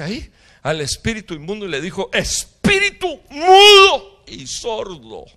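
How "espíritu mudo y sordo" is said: huge high shout, drawn out; shouted rebuke. Retold or imitated. Jesus rebukes the unclean spirit, shouting slowly. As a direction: command it out with full force. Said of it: "reprendió".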